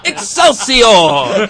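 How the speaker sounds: loud, high, drawn-out triumphant cry; Stan Lee catchphrase. Retold or imitated. Podcaster mimicking Stan Lee's catchphrase, loud and laughing. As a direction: belt it out like a showman. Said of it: "Stan Lee would go".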